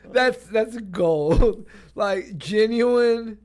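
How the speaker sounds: high and loud, laughing hard; helpless laughter at a good joke; cracking up. Real, not acted. Cracking up at a friend's joke, high and loud.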